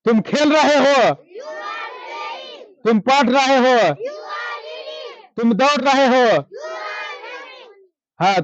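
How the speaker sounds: shouted classroom drill prompts; calling out drills. Acted. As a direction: belt each prompt to the whole room. Teacher drills class aloud.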